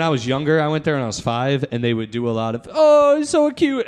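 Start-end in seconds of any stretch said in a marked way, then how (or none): 2.7-3.9 very high, slow doting aunt voice; mock baby-talk coo; cooing relative. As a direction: coo it like a relative pinching a kid's cheek. Relatives cooing over child.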